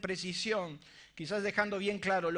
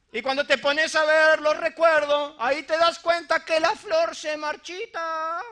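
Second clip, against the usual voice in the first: raised preacher's call for the crowd to repeat; call and response preaching; 8 semitones higher, 9 dB louder than usual. Acted. Pastor leading the congregation in repeating the verse, raised voice. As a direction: lead the room in the refrain.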